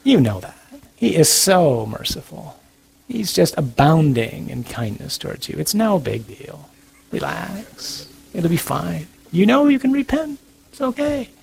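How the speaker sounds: soft, coaxing voice on the shoulder; hushed, soothing little devil's whisperings; little evil tempter. Acted. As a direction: lull them softly with false reassurance. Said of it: "that little voice is on your shoulder going".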